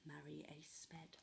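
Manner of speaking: hushed, secretive whisper; whispered warning. Acted.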